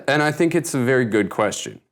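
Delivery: ordinary talk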